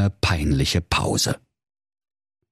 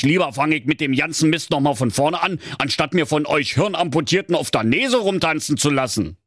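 higher-pitched exasperated donkey; fed-up donkey; 9 semitones higher than usual. Acted. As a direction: rant as the donkey who has had enough. (genervter Esel, höhere Stimme)

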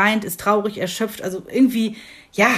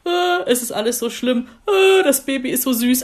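lightly imitated blubbering, a bit higher; mock tearful wail; 4 semitones higher than usual. New mum recalling her weepy days, mock blubbering. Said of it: "ich hab einfach nur rumgeheult".

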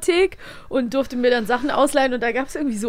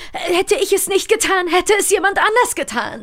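high, loud mock excuse; hammed-up quoted excuse; quoting a sly excuse; 9 semitones higher, 5 dB louder than usual. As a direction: parrot the villain's weak justification. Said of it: "wie du ja sagst".

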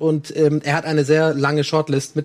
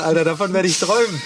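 ordinary talk, nothing marked

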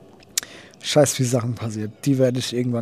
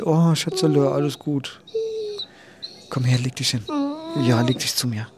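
gently calm a frightened pet; soothing a pet; 4 semitones higher than usual. Real, not acted. Soothing the dog on her lap.

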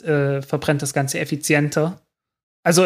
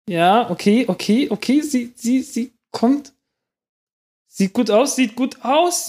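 from excited to thrilled, rising higher; immer aufgeregter; 7 semitones higher than usual. Real, not acted. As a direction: build the excitement as the rocket comes down. Rakete landet live, Spannung steigt. (von aufgeregt zu hochaufgeregt, immer höher)

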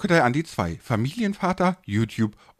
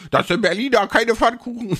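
mock viewer voice; 10 semitones higher than usual. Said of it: "Die fangen jetzt an so".

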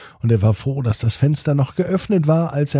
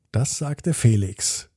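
ordinary delivery

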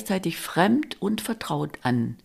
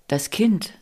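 plain reading voice

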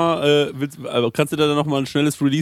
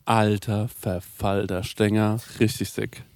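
slow, quiet, spaced-out speech; stoned; 6 semitones lower, 6 dB quieter, slower than usual. Real, not acted. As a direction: drag the words out, dazed. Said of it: "Ich bin richtig high".

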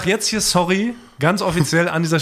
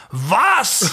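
outraged shout, higher pitched; retold shout; 4 semitones higher than usual. Colleague at the empty fridge, retold outraged shout. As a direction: yell it in mock fury. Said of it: "schrie er".

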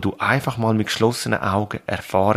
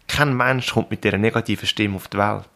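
normal talking voice

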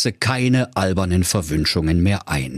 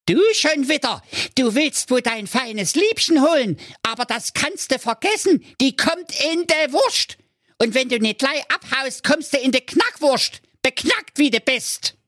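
very high, shrill-sounding witch voice; a raging old witch scolding the youth; furious witch. Acted. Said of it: "wurde fuchsteufelswild". (sehr hohe, wütende Hexenstimme)

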